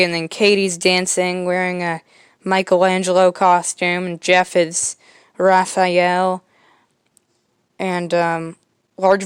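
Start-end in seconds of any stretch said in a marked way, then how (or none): none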